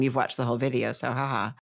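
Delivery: normal talking voice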